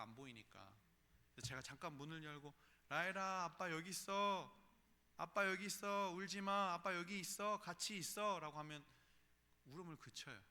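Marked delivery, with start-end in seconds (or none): 2.9-8.4 put-on loud, calling to a crying child behind a door; calling out reassuringly. Father calling to his crying daughter from the bathroom, loud and reassuring. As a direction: raise your voice to reach her and soothe her.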